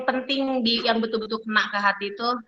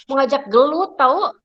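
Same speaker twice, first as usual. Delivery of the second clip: picking a fight; 4 semitones higher, 7 dB louder than usual. Shown as a demonstration.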